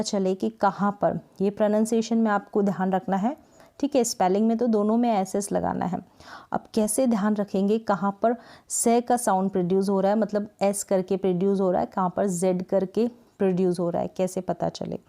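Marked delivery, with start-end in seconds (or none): none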